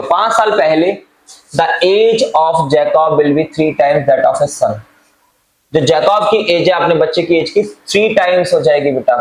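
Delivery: ordinary delivery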